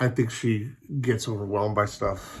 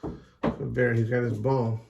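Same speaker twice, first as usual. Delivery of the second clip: ordinary delivery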